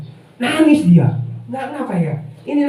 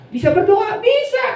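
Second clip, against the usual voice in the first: much higher playful back-and-forth; mock eager exchange; 10 semitones higher than usual. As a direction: squeak out a cheerful yes. Acting a quick exchange.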